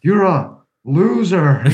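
hammed-up slow jeer; mock taunt. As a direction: stretch it out like a playground jeer. Friend quoting a mocking line.

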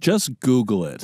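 slow, pointed passive-aggressive jab. Real, not acted. Teasing the guest about her plugs, passive aggressive. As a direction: say it slow with a cool edge. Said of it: "that was passive aggressive".

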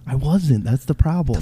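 whisper. Real, not acted. Hushed reply to scolding.

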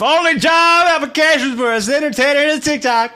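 high, loud rock voice; talking rock. Acted. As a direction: belt it like a rock singer. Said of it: "do voices".

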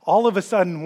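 ordinary talk

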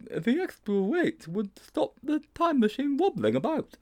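put-on high-pitched boyish character voice; high, hesitant schoolboy; timid Sponge, reluctant. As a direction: wriggle out of it in a high voice.

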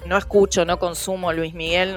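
ordinary delivery

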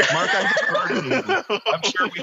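laughing through the words; giggling, quick and high. Real, not acted. Excited offer, laughing.

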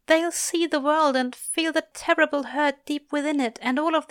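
high-pitched grieving voice; pained lament. A narrator's reading. Angel fearing for the children's innocence, pained. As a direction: mourn it in a high, aching voice. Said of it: "mirroring Aziraphale's own current pain".

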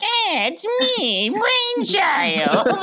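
very high, slow cartoon villain voice; Brainchild voice. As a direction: squeak it out slowly. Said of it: "he has that voice".

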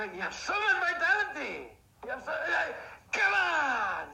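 high, loud burst of elation; ecstatic fan cheering. Acted. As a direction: shout it like your team just won. Acting out sports fan's victory.

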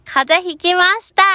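high loud cute anime voice; high cute anime girl. Pretend. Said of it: "アニメ声".

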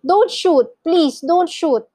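high, loud pleading; desperate call for mercy; frightened plea. A narrator's reading. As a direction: beg urgently for your life. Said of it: "called out Mister and Missus Gregg both together".

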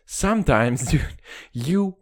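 ordinary talk

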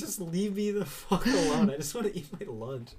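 mock annoyed loner. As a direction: exaggerate his wish to be left in peace. Retold loner wanting solitude.

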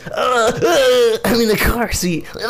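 high whining imitation of cramps in a car; mock pained whimper. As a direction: act out squirming in a car seat, whimpering. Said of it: "So I'm just like this".

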